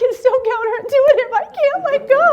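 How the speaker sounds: high, loud hysterical protest; comic frantic refusal; hammed-up panicked wail. Yells back in panic.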